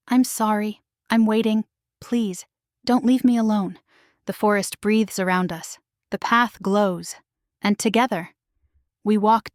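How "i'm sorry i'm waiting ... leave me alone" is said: usual speaking voice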